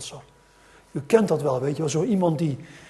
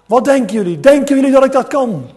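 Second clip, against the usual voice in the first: loud, higher, rousing call to the crowd; showman asking the crowd; 8 semitones higher, 13 dB louder, faster than usual. Acted. Tightrope walker calling out to the crowd, loud and rousing. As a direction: whip up the audience like a circus star. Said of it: "zei hij tegen die mensen".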